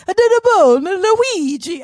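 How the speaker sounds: high, loud cartoon Italian accent; hammed-up mock Italian movie accent; Jared Leto. Host imitates the Gucci movie actor, mock Italian. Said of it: "It is Jared Leto".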